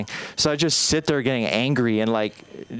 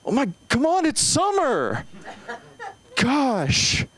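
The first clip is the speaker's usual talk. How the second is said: put-on high, whining child; whiny kid to mom; 11 semitones higher, slower than usual. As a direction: whine like a kid begging mom. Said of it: "I'm like".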